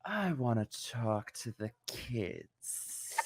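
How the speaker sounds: slow, soft creepy voice; Jeffrey Marsh impression. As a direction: drag it out slowly and softly, creepy. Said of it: "Can you do an impression of him right now for us?".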